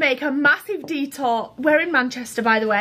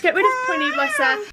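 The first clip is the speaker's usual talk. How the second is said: mock sad; 12 semitones higher than usual. Repeats mock lament about dog.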